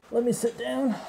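talking through a face mask; muffled behind a respirator mask. Real, not acted. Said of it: "sorry if it sounds a little muffled".

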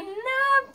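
a high, loud wail as she breaks down crying; retold sobbing cry. Girl bursting into tears at her friend's house, wailing. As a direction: sob out the no. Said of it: "Me largué a llorar a mocote".

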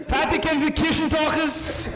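put-on high, loud little-kid babble; babbling toddler voice. Three-year-old taunting his dad in a high babbling voice. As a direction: chirp it like a smug toddler.

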